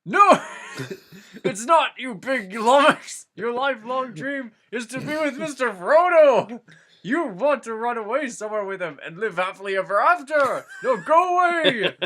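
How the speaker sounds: hammed-up furious wizard, cracking up; Gandalf yelling. Gandalf shouting Sam down, barely holding back laughter.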